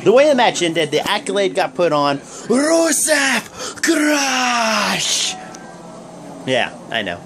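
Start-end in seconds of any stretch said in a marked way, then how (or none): 2.2-5.3 very slow, drawn-out shout, pitched high; wrestler catchphrase shout. Retold or imitated. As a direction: bellow the catchphrase like a wrestling crowd chant.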